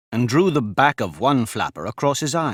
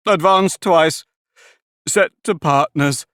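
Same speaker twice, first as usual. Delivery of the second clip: high, louder, slow calling out; excited explaining; 6 semitones higher, 5 dB louder, slower than usual. A narrator's reading. Mock Turtle calls out the dance steps to Alice, high and loud.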